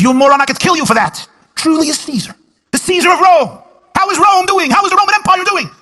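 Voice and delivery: high, loud outraged bellow; furious shout. Acted. Caesar roars at the airport greeter, furious shout. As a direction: roar it like an insulted emperor.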